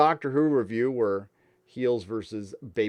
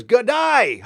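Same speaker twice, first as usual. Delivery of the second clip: mock Australian greeting; 10 semitones higher, 4 dB louder than usual. Reacting to Australian donation.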